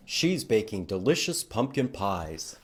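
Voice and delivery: normal talking voice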